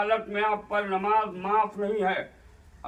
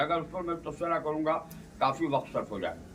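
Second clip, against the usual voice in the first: usual speaking voice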